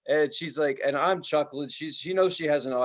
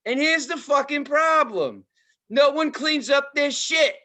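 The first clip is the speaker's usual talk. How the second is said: escalating rant; 10 semitones higher, 5 dB louder than usual. Retold or imitated. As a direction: wind up the outrage bit by bit. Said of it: "she's like".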